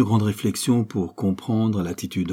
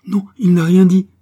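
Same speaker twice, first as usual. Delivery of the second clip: high, loud burst of emotion; blurting out the news, overwhelmed; overwhelmed outburst; 10 semitones higher, 11 dB louder than usual. A narrator's reading. Daughter blurts to her mother, high and loud. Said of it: "expliqua Ruth".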